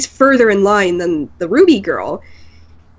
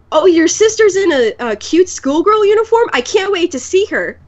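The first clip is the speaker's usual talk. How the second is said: higher-pitched, over-keen creep; creepy guy; 5 semitones higher than usual. Retold or imitated. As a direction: imitate an over-eager creep. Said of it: "the creepy guy".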